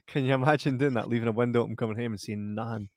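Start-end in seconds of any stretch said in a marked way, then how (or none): none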